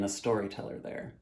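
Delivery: ordinary delivery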